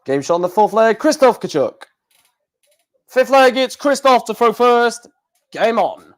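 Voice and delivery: lightly voiced darts score caller; Russ Bray. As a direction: announce the leg like a darts caller. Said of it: "I'll be Russ Bray".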